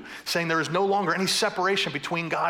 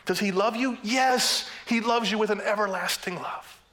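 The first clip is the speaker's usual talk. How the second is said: higher, raised, emphatic sermon delivery; impassioned preaching; 5 semitones higher than usual. Real, not acted. Preacher tells the congregation God loves them, preaching fervently. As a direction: preach it with full conviction.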